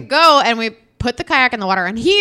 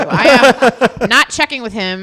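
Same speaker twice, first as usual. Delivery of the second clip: laughing through it; 2 semitones higher, 8 dB louder, slower than usual. Retold or imitated. Accepting beer, laughing.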